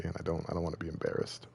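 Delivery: drops to a sheepish whisper; embarrassed whisper. Real, not acted. Streamer confessing to chat he'd fail, whispered. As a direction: breathe it out, ashamed to admit it.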